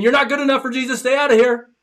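high, loud mimicking of the religious critics; sneering imitation of scolding church people; mock critics. Preacher mocks the critics turning outcasts away, loud and high.

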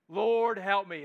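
loud plea, help falling; crying out to God. Shown as a demonstration. Pastor models a plain cry to God, loud. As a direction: cry Lord, help me, raw and simple. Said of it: "Just a crying out to God".

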